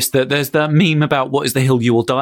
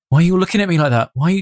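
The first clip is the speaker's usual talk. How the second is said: normal talking voice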